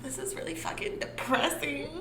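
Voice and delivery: laughing while tearing up; half laughing, half crying; crying through laughter. Real, not acted. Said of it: "I'm crying".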